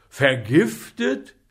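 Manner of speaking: loud, slow rising Vergiftet; shocked apothecary asking; horrified question. A narrator's reading. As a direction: stretch Vergiftet out in disbelief.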